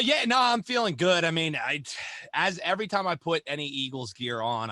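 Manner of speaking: ordinary talk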